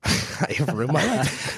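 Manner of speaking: slow, laughing through the words; laughing dismay. Retold or imitated. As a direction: laugh at your own mock despair, slowly. Retelling his panic at the news.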